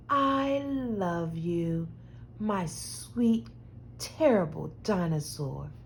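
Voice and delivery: tender softly spoken. Acted. Mama tucks child in, tenderly. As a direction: tuck them in gently.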